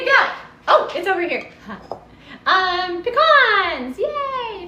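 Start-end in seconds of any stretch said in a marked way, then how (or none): 3.0-4.7 excited. Real, not acted. Reveals the pecans.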